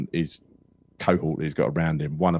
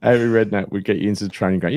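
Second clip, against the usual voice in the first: ordinary talk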